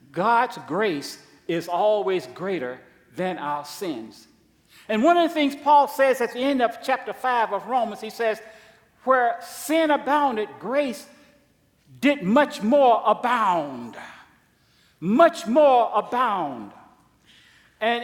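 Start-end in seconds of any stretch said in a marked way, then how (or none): 0.1-4.3 slow and weighty, stressing God's; a slowed, emphatic restatement, dropping on sins; slow, deliberate repetition. Real, not acted. Repeated slowly for emphasis. 9.0-14.0 slow, with weight on more; a slow preacher's quote of Paul; slow emphatic quote. Real, not acted. 15.0-16.7 slow, louder repeat, stressing more; slow echo. Real, not acted. Echoed slowly for emphasis.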